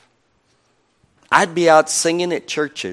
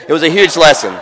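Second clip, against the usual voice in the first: a laughing, louder redo; louder laughing retake; 3 semitones higher, 7 dB louder, faster than usual. Shown as a demonstration. Preacher redoing a line after apologizing, laughing. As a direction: say it again louder, laughing through "huge". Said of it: "I still have my accent. Sorry".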